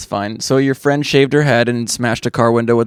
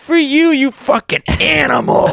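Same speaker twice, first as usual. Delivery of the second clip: high, slow taunting voice to a bug; mock taunt at a moth; 11 semitones higher, slower than usual. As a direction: slowly gloat over the squashed moth in a high voice.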